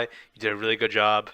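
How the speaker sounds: normal talking voice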